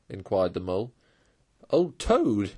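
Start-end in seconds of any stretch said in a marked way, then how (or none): 1.7-2.5 hearty laugh. A narrator's reading. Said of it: "and laughed heartily".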